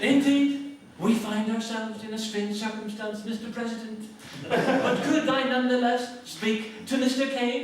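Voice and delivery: high, slow, unruffled posh statesman's voice; dry, measured put-on aristocratic reply; Enoch Powell impression. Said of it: "This is Enoch Powell".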